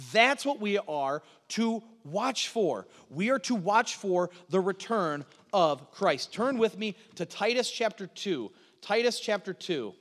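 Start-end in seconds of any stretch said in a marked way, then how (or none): 0.1-6.3 slow and deliberate, every word weighed; slow, weighty. Shown as a demonstration. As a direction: land the main point slowly. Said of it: "closing point, slowed".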